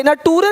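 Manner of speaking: "नटूरे" drawn out high, final e voiced; spelling misreading. Shown as a demonstration. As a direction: say "नटूरे" slowly with a hard t. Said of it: "नटूरे नहीं".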